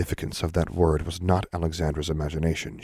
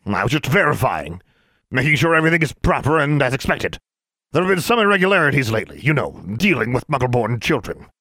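high, louder, put-on official voice; posing as a man; 6 semitones higher, 4 dB louder than usual. A narrator's reading. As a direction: bluff officiously to cover your ruse. Disguised Alexandra covers up as Mister Brown.

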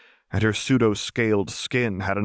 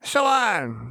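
Jinx, manic; 12 semitones higher than usual. A narrator's reading. Jinx, manic, changes the subject.

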